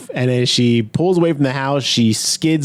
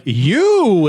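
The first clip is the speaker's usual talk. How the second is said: raised, drawn-out accusing call; mock startled recognition; 5 semitones higher, 3 dB louder than usual. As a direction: exclaim it with a hammed-up raised eyebrow. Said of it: "he does the, like, raised eyebrow".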